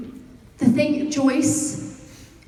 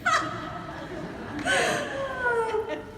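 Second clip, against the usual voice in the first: long, high laugh trailing off; 14 semitones higher, 5 dB quieter than usual. Real, not acted. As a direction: laugh helplessly at your own blooper. Laughing at her own blooper.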